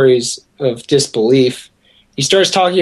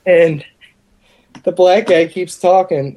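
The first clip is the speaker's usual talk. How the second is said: ordinary delivery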